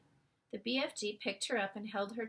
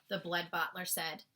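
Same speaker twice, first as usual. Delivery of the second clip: plain reading voice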